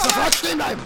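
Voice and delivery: barked halt, high and strained; soldier's order. Acted. Soldier ordering fleeing civilians to halt, barked command. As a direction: stop them dead in their tracks.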